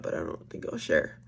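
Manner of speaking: hushed, secretive whisper to the viewers; whispering the aside. Real, not acted. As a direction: drop to a breathy whisper, like keeping a secret. Secretive aside about a story.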